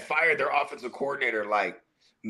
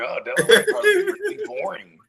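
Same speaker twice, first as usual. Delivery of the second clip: much higher, mock childish voice; kid voice; 3 dB louder than usual. Remembering himself as a kid, high childish voice. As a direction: sound like a naive kid. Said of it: "I'm like".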